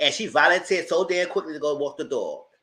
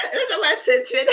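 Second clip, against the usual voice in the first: laughing; faster than usual. Real, not acted. Laughing at herself.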